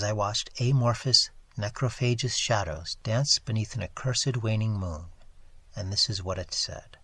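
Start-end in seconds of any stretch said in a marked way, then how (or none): none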